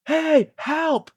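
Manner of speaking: high-pitched pretend cry for help; mock yell. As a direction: call out for help without really shouting. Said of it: "Just yell".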